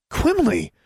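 high startled cry of a name; surprised exclamation. A narrator's reading. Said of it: "she exclaimed".

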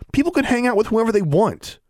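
high, heated insistence; indignant protest. A narrator's reading. David argues with the Ozarker over company, high indignant protest. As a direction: argue back with the pitch held high.

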